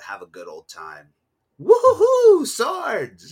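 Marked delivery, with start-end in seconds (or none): 1.6-3.3 high, loud mock whoop; mock cheer. Retelling watching the film, a loud mock whoop. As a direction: cheer like a kid seeing swords.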